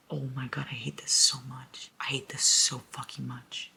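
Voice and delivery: hushed, pained whisper; whispered anguish. Real, not acted. Reader whispers anguish to viewers over the story. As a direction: whisper your frustration.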